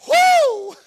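a high, loud whooping cry; excited preacher's whoop; joyful whoop. Real, not acted. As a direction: whoop with joy.

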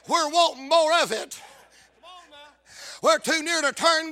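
high, pleading revival cry; impassioned preaching. Real, not acted. Preacher voicing disciples urging Jesus to come in, impassioned preaching. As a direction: build to a long, shouted climax.

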